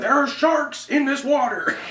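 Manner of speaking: high, slow mock panic; dad's shark warning retold, high and slow; scared dad. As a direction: drag it out high like a frightened man. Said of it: "the look on my dad's face".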